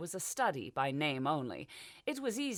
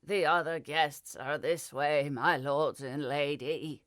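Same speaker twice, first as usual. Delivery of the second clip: put-on old butler; 5 dB louder, slower than usual. A narrator's reading. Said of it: "Percy shuffled off".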